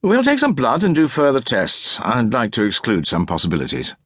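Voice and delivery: doctor all business now; brisk and professional. A narrator's reading. Doctor to patient, lightly voiced brisk professional tone. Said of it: "Voice suddenly brisk and professional".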